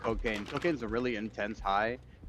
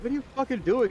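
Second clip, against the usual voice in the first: higher, louder, incredulous; mock bewildered outburst; 14 semitones higher, 4 dB louder, faster than usual. Retelling friends yelling at a tripping buddy, bewildered. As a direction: retell the friends' baffled yell at him. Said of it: "we're like".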